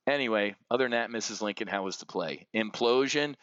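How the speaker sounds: ordinary talk, nothing marked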